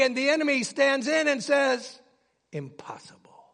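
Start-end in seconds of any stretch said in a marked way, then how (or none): 2.5-3.4 very soft, low, near whisper; hushed taunt. Acted. The enemy stands in and says, hushed taunt. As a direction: drop to a low, quiet murmur. Enemy answers hope with a hush.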